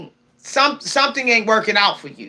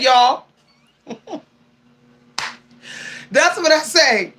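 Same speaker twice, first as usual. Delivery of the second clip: usual speaking voice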